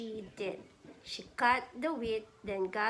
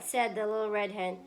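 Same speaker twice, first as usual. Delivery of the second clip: ordinary delivery, nothing marked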